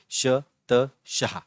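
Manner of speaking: "श त शाह" in separate syllables with short gaps; letter by letter. Shown as a demonstration. Teacher reading the first example word, letter by letter. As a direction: sound out "श", "त", then "शाह".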